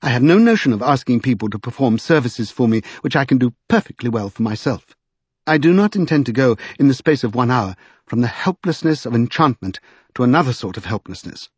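plain reading voice

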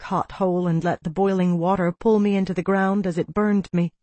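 plain reading voice

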